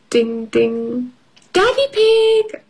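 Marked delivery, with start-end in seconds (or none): 1.5-2.6 very high call across the house. Acted. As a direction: call him from another room. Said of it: "Mummy calls".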